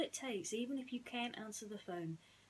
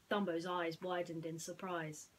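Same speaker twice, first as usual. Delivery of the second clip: ordinary delivery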